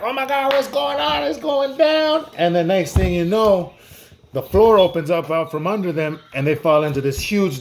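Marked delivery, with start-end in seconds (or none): 0.0-2.3 panicked. Acted. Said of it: "Joe and Smith are in there like".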